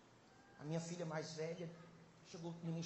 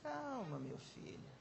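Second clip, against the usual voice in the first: God's calming voice; 2 semitones higher, 5 dB quieter, slower than usual. Acted. God reassuring an anxious child, calming voice. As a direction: soothe gently, like a father to his child. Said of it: "A voz de Deus sempre é".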